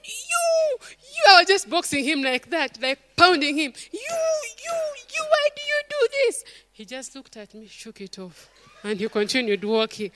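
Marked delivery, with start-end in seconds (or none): 0.0-1.2 high-pitched scolding yell at her son; mock angry scolding. Mother scolding her son on the staircase, high and angry. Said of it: "Kwezi made me so angry". 3.9-6.4 high-pitched scolding yell at her son; mock angry scolding. Mother scolding her son on the staircase, high and angry. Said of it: "Kwezi made me so angry".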